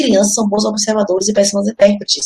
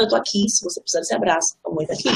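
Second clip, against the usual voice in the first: soft, hushed offer of a hug; gentle reassurance; 6 dB quieter, faster than usual. Retold or imitated. Mother offering her crying child a hug, hushed and tender. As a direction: murmur it tenderly, close to her.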